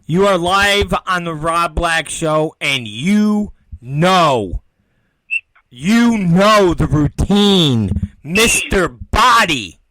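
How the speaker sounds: loud, slow announcer welcome; radio host greeting. Acted. Host welcoming a caller on air, slow loud announcer voice. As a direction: stretch it out like a talk-radio host.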